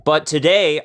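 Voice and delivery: ordinary delivery, nothing marked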